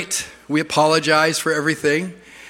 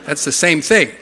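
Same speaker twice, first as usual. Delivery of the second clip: ordinary talk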